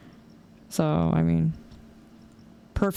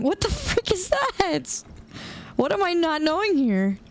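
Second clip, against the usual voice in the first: exasperated disbelief; 9 semitones higher than usual. Real, not acted. Chat keeps pushing goth topic.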